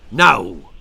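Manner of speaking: deep, loud flat refusal; gruff farmer. A narrator's reading. Farmer refuses the children's offer, gruff. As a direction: bark the no like a hard farmer.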